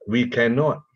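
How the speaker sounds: ordinary talk, nothing marked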